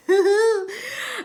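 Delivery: short high burst of laughter; bright laugh. Real, not acted. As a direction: laugh out fondly. Laughs reading Isaac's name.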